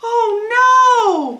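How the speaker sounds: high, loud panicked cry; alarmed cry. Acted. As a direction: wail it out in alarm. Piggy panics at the sneezing.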